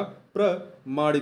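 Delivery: very slow, deliberate "प्रमाणिक"; slow spelled-out word. Shown as a demonstration. Teacher to students, spelling the word out slowly. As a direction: drag out "प्रमाणिक" syllable by syllable. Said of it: "पा पे आ की मात्रा होगा".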